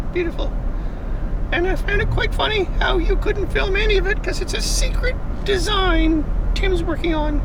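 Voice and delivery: high, playful put-on voice held high throughout; cheeky high falsetto sidekick; Angel's voice. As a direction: answer yourself in a squeaky made-up character voice.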